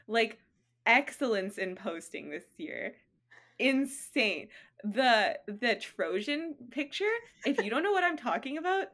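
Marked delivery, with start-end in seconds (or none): none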